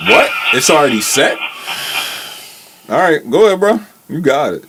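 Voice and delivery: ordinary talk, nothing marked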